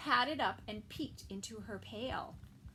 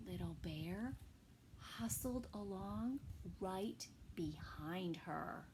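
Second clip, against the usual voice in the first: whispered narration; 5 semitones lower, 9 dB quieter than usual. A narrator's reading. Reader whispering as little bear sneaks behind. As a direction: hush it like a secret.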